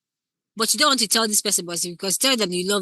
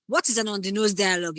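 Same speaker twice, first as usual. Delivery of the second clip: usual speaking voice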